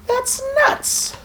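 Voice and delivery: high squeaky amazement. Real, not acted. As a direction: squeal it in disbelief. Glitchy tree through wall.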